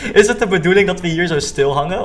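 laughing through a question; nervous laughing. Real, not acted. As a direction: giggle while asking. Coaster stops, nervous laugh.

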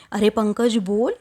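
plain reading voice

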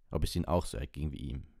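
ordinary delivery

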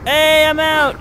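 high, loud triumphant cry; excited relief. Real, not acted. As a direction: call out your escape in excitement. Escapes in the game.

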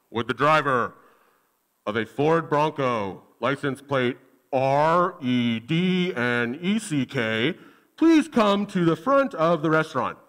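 a hint of a waitress paging over the speakers. Waitress paging the owner of the car, mild announcer voice. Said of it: "So she's like".

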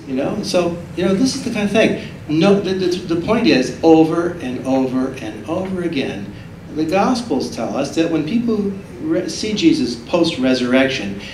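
normal talking voice

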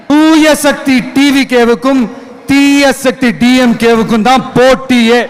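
ordinary talk

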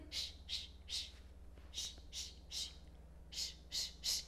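soft voiceless shushes; very quiet, rhythmic shh; mothers shushing. Acted. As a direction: hush a crying baby in rhythm. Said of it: "Las mamás en el bus dicen".

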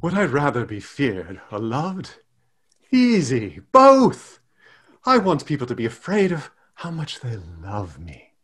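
put-on soft British accent, lovestruck; British, eternally in love. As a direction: play it British and hopelessly in love. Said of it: "as a British accent".

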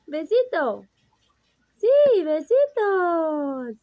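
high, coaxing sing-song. Real, not acted. Coaxing kisses from the baby.

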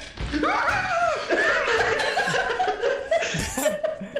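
high shrieking laugh. Real, not acted. Bursting laughter.

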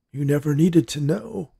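a hint of a frail old man confiding; ailing grandpa. A narrator's reading. Grandpa confides in his granddaughter, lightly voiced.

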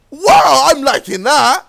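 high, loud delight; thrilled exclamation. Real, not acted. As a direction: cheer it with real relish. Raves after finishing a run.

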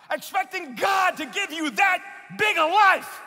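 rising accusing challenge, building to a shout; mocking accuser. Doubter scolding a believer who prays big, accusing shout. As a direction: sneer it, then shout it down at them. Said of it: "looking at you saying".

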